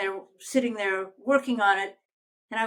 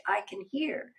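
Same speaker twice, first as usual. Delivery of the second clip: ordinary talk, nothing marked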